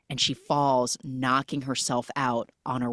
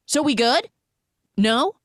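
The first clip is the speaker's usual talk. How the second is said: pushy checking-in; 10 semitones higher, 6 dB louder than usual. Retold or imitated. Acting out pushy question after screams.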